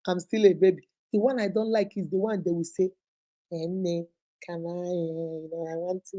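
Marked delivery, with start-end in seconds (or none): 3.5-6.2 soft, slow, timid asking; a small coy voice fishing for something; coy hesitant girl. Retold or imitated. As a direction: hedge shyly, voice dropping low.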